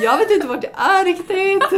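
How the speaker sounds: high, loud re-enacted phone yell; shouting over noise. Lost at a noisy event, phoning friends, loud yell. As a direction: yell as if nobody can hear you. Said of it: "det var ju jättehög volym runt omkring".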